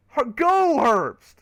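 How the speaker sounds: high, loud exasperated yell; urgent shout. Real, not acted. Streamer yelling at Herbst on the restart, urgent. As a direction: shout the driver into moving. Urging a stalled driver to go.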